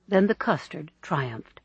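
ordinary delivery

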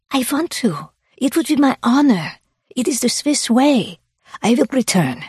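put-on high-pitched, earnest Swiss accent; insistent Swiss woman. Swiss woman insisting on helping a hiker, high Swiss accent.

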